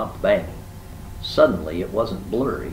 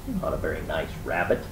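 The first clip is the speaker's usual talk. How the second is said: plain reading voice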